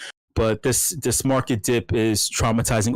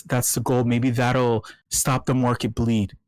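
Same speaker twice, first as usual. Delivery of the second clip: ordinary talk, nothing marked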